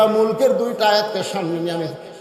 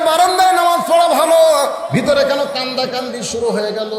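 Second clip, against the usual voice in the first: shouting; 6 semitones higher than usual. Acted. Shepherd shouts at the weeping worshippers inside, shouting. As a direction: cry it out across the mosque. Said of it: "রাখাল চিল্লায় বলে".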